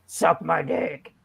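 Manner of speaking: low, slow crude comeback; deadpan correction. Acted. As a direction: drop low and draw it out. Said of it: "Is that better?".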